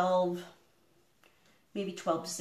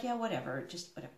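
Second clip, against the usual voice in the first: dismissive mutter; 4 semitones lower, 10 dB quieter, faster than usual. Retold or imitated. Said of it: "And he's like".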